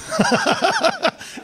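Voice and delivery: real falsetto giggle; high squealing laugh. Real, not acted. Comedian laughing at the Haredi dog joke, high squeal. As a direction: laugh up high at your own punchline.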